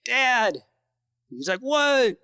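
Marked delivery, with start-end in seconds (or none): 0.0-0.6 restrained re-enacted holler; calling up. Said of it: "I yelled up to him". 1.6-2.1 high-pitched called-back reply; retold holler, pitch up, volume held; calling back. Dad calling back down from cliff.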